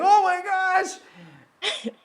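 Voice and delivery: high, drawn-out slow "oh my gosh"; stunned joy. Retold or imitated. Teen reading his acceptance letter, high stunned surprise. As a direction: let the amazement stretch out.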